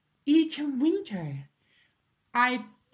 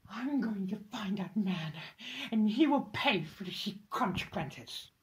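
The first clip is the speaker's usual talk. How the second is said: ordinary delivery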